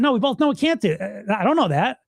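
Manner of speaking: sarcastically dispute the put-down; sarcastic comeback. Acted. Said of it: "turn into a sarcastic good voice".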